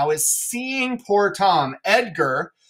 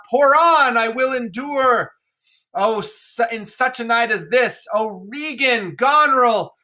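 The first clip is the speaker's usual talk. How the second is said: raging Lear; 4 semitones higher than usual. Acted. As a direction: cry out against the storm and daughters. Teacher reads Lear's rant.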